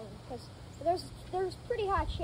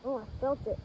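ordinary talk

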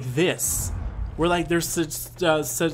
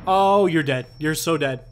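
normal talking voice

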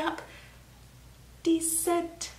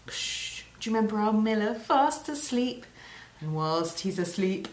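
ordinary talk, nothing marked

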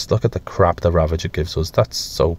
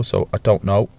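ordinary talk

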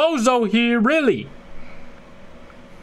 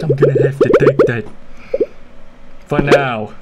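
deep mock growl; 8 semitones lower, 3 dB louder than usual.

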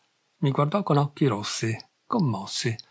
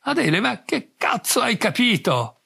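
exasperated outburst; 8 semitones higher than usual. Acted. Exasperated man to girlfriend.

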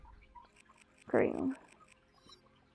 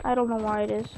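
ordinary delivery

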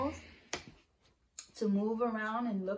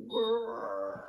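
bear growl; 3 dB louder than usual. Shown as a demonstration. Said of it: "now we're going to be a bear".

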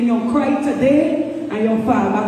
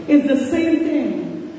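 normal talking voice

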